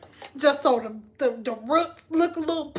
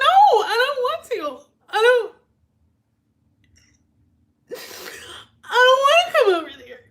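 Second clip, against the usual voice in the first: whiny refusal; 8 semitones higher, 3 dB louder, faster than usual. Retold or imitated. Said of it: "And I would be like".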